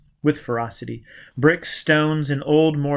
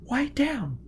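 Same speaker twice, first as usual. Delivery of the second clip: put-on young woman voice; 13 semitones higher, 5 dB quieter than usual. A narrator's reading. Gisbella's voice.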